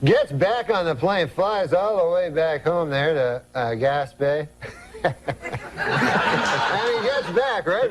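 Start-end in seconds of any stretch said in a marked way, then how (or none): none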